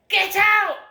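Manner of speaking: sudden high, very loud yell; imitating mum's furious shout. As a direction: bellow it like an order to leave. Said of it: "she shouted at me like that".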